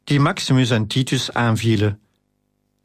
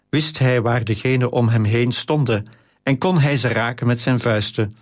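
ordinary delivery, nothing marked

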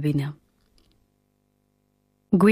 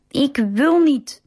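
put-on slow, higher, stubborn child refusal; 7 semitones higher, slower than usual. A narrator's reading. Josué refusing his bath, stubborn and slow. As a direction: dig in your heels, slowly. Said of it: "zegt Josué koppig".

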